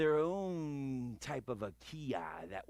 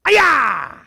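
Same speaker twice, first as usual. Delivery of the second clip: loud "aya!" falling away; kia yell; 8 semitones higher, 18 dB louder than usual. Shown as a demonstration. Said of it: "vary up your kia".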